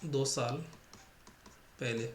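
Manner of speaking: ordinary talk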